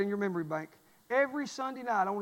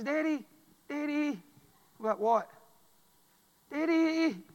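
lightly higher, wheedling Daddy; imitating daughter; 5 semitones higher, slower than usual. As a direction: plead like a daughter after her way. Daughter voiced whining for her way.

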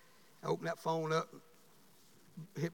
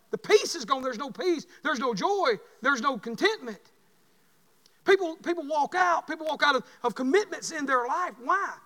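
rising, louder preacher's cry; fiery preaching; 6 semitones higher, 6 dB louder than usual. Acted. Pastor warning the church about lost peace, preaching at full force. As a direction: preach it hard to the congregation.

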